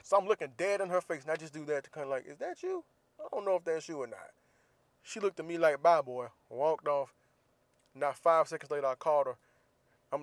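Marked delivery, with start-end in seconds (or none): 2.2-4.3 high, teasing, pretend not to recognise her; mock squinting query. Husband teasing wife across store aisle, squeaky mock-confused voice. 5.8-6.3 annoyed brush-off. Retold or imitated. Wife quoted brushing him off.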